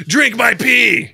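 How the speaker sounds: high, loud, drawn-out taunt; gloating mock villain. Prankster gloating over the ice trays, mock-villain shout. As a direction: crow it slowly like a cartoon schemer.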